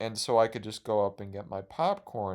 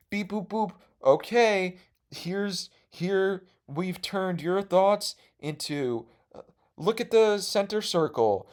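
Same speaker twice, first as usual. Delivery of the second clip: high playful host; 8 semitones higher, slower than usual. Retold or imitated. Host voiced with machine.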